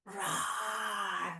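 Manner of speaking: low, soft pretend roar; cat growl. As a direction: growl quietly like a big cat. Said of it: "Some cats?".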